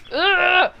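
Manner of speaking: put-on growl of frustration; frustrated ugh, hammed-up; exasperated ugh. Mimics exasperated potion customer.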